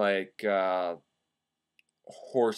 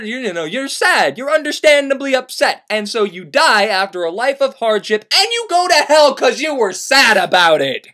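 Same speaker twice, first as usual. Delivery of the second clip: normal talking voice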